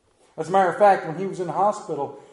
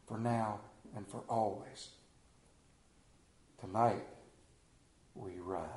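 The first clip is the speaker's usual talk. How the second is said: softer, lower closing whisper of the line; quiet benediction; hushed refrain; 6 semitones lower, 9 dB quieter than usual. Shown as a demonstration. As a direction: let it fall away into a near hush.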